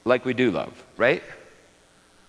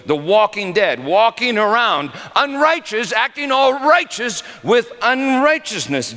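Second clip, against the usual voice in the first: high, rising preacher's fervor; impassioned preaching; 10 semitones higher, 3 dB louder than usual. Acted. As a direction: build it like a pulpit climax. Preaching climax on hypocrisy.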